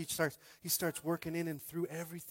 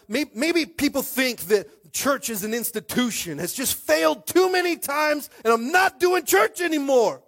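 mock outcry; 11 semitones higher, 11 dB louder than usual. Mocking people who quit church.

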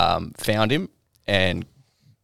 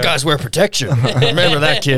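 put-on drunk old man voice; 7 semitones higher, 6 dB louder than usual. Friend improvising Carl's voice.

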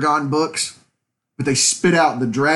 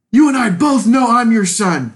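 loud, higher-pitched quote of an anime character; Future Trunks; 8 semitones higher, 5 dB louder than usual. Retold or imitated. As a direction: deliver an abridged-anime line, raised and forceful. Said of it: "when future Trunks shows up".

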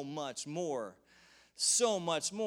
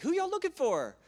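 sly friendly stranger; casual trickster; 3 dB louder, faster than usual. Retold or imitated. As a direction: play it cool, misleading them. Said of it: "He's like".

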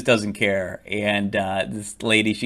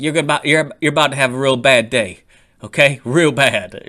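loud, raised imitation of a threat; ticked off; 4 semitones higher, 8 dB louder than usual. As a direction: mimic an angry villain warning someone. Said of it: "getting all ticked off".

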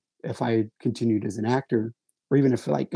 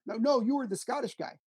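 higher, lightly voiced insistent fan; voicing a fan; 11 semitones higher, 5 dB quieter than usual. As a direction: play the doubting audience member. Audience member quizzing him.

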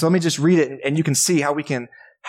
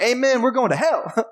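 mock amen; 9 semitones higher than usual. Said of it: "no one in the crowd being like".

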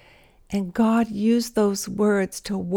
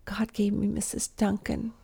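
whispering; 7 dB quieter than usual. Acted. Intimate testimony.

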